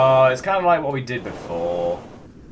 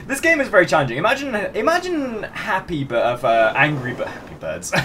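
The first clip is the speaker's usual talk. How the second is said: ordinary talk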